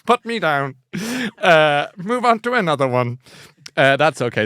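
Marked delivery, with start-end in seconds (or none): 0.1-3.2 lightly voiced bot giving permission; a hint of a slow, obliging bot; helpful bot. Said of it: "I'm BookBot".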